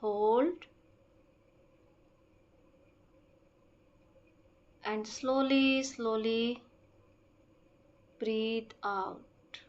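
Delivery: very slow, measured meditation voice with stretched-out words and long pauses; guided breathing meditation. Acted. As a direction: guide them slowly through each breath. (धीमी, शांत ध्यान कराने वाली आवाज़)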